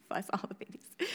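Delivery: real whispering with soft laughs. Real, not acted. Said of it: "my baby's crying".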